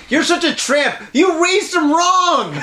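high, drawn-out mock shriek; hammed-up catfight insult; girlfriend fighting with mom. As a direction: wail the accusation in a high put-on voice. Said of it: "having your girlfriend fight with your mom".

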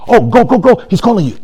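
high, urging crowd voice; acted bystander hurrying someone along; excited crowd. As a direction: egg him on with repeated little pushes. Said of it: "And then the crowd".

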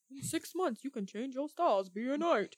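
softly put-on mock ad voice; fitness infomercial pitch. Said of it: "like that bow body flex thing".

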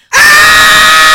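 very high, loud, sustained scream; piercing all-out release scream; full-might therapy scream. Shown as a demonstration. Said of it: "screaming therapy that I do".

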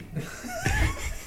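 high-pitched burst of laughter; high laughing squeal. Real, not acted. Laughs after spitting.

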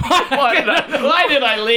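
high, laughing wail of regret; mock despair. Acts out his despair, stranded.

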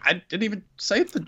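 high whiny complaint. Retold or imitated. King voiced by S1.

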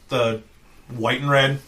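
ordinary talk

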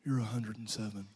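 very low, hushed aside; mock disdainful look. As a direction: drop it low and quiet, imitating the boys. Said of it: "they give me one of those looks like".

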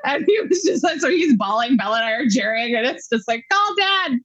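high, tearful voice; crying while telling. Real, not acted. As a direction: overcome by the memory.

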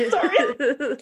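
real laughter breaking through a quick sorry; giggling sorry; laughing apology. Real, not acted. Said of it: "I'm laughing just thinking of the story".

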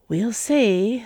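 airy and unconcerned; unbothered. A narrator's reading. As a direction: brush off the rejection lightly. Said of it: "not sounding bothered by the rejection".

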